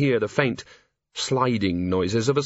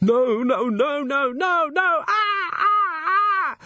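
rising, much higher panicked cries; terrified scream; much slower than usual. Acted. Thief screaming outside as the growl attacks. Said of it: "modulated with".

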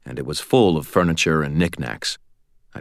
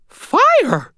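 sudden loud, high squeaky cry; abrupt alarm; 5 dB louder than usual. A narrator's reading. Old lady suddenly spots the flames, abrupt squeaky shout. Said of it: "she said abruptly".

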